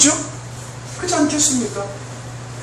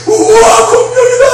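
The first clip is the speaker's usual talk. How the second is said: very high, thrilled exclamation; hammed-up wonder, pitch shooting up; mock childlike amazement; 13 semitones higher, 5 dB louder, slower than usual. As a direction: squeal like a kid handed a giant present. Acts a child thrilled by dinosaur.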